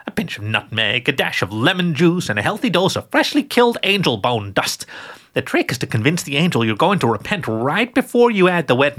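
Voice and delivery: put-on a doting old witch sharing her recipe; sweet old witch. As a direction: croon it like a kindly granny who bakes angels. Gertrude's voice in story.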